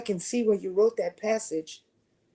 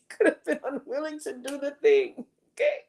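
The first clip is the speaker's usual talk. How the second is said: laughing; 7 semitones higher than usual. Real, not acted. Jokingly listing possible failures.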